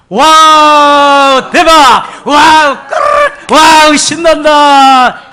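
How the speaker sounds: long, high, loud drawn-out wow; preacher leading the congregation in a childlike cheer; leading group cheer. Shown as a demonstration. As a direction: lead the crowd in a gleeful shout. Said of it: "다 같이 시작".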